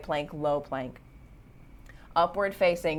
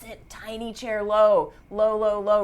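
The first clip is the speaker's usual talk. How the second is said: louder, higher coaching push; energizing push; 4 semitones higher, 6 dB louder than usual. Real, not acted. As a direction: drive them down into the chair. Teacher pushing class deeper in chair.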